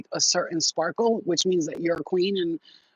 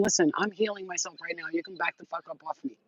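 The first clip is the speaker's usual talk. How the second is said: softer, firm back-off; firm brush-off; 7 dB quieter than usual. Pretend. Telling doubters to back off, quiet firm warning. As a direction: shut them down quietly. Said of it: "you tell them".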